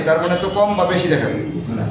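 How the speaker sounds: normal talking voice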